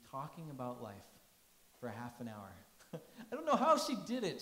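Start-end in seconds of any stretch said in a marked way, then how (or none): 2.9-4.4 amused disbelief; laughing fondly. Real, not acted. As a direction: laugh then speak, louder. Laughing at mom's tricks.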